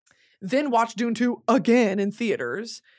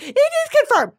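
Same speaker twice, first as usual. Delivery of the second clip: high, loud mocking squeal; mock conspiracy believer; 7 dB louder than usual. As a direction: parody an overexcited believer. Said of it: "some people could be like".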